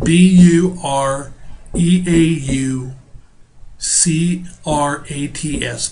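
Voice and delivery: slow letter-by-letter spelling. Shown as a demonstration. Teacher spelling a word for students, slow and deliberate. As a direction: spell each letter slowly for the class. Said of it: "it's spelled".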